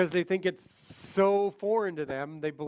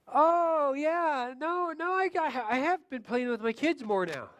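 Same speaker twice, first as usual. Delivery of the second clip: much higher, flustered client voice; client Mary; 8 semitones higher, slower than usual. Acted. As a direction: catch yourself, then admit it eagerly. Client Mary recalling improvement.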